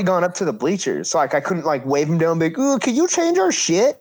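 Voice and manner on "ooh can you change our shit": high put-on whiny voice, much higher; mock pleading. Wrestler imagining begging the bookers, high mock whine. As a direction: beg like a helpless kid.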